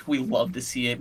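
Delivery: normal talking voice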